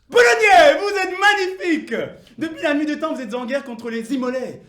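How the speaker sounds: high, booming acclamation; grand shouted praise, easing slightly as it goes; rousing oratorical shout. Acted. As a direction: hail the crowd at full force. Orator hails the crowd.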